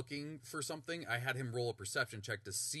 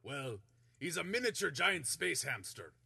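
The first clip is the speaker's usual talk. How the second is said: loud, higher proud introduction; Minsk voice; 7 semitones higher, 5 dB louder than usual. Acted. GM as Minsk presenting his hamster Boo, loud and proud. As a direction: show off your pet proudly. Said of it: "He goes".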